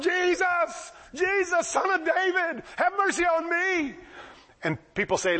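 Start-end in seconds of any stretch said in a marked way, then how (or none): 0.0-3.9 high-pitched, drawn-out beggar's cry; hollering. Retold or imitated. Blind man calling to passing Jesus, hollering. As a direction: call out desperately over a crowd, pitched high. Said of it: "He starts hollering".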